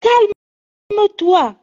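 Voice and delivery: slow, high, drawn-out calme-toi; soothing. Real, not acted. Mother soothes her crying daughter, slow and drawn out. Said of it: "Je t'ai dit, calme-toi".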